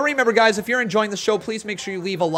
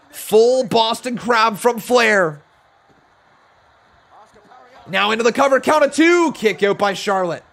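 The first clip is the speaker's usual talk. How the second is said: lively ringside match call; live play-by-play; 7 semitones higher, 7 dB louder than usual. Acted. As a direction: call the action as it happens.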